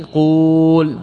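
قول as a slow quuul, long held u; drawn-out vowel. Shown as a demonstration. Teacher showing the imperative's origin, long drawn-out قول. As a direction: stretch the vowel of قول right out. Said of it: "هذا الأصل".

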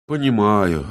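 drawn-out falling sigh; understated bitter acceptance. A narrator's reading. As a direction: let the word sink with resignation. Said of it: "горько вздохнул Виссарион".

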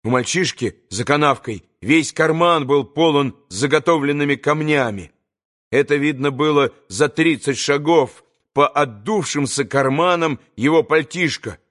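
ordinary delivery

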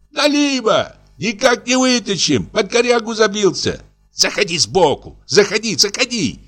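very high, squeaky tenor shouting; squealing excited reply. A narrator's reading. Fisherman answering the shepherd and directing his mate, squealing. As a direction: pipe up urgently in a high squeal.

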